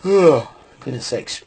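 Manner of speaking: talking through a yawn. Real, not acted. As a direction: yawn the words out slowly. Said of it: "excuse me for yawning".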